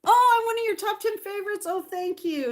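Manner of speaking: high, quick burst of delight; delighted thanks. Real, not acted. Streamer learning she is a viewer's favorite, delighted and high.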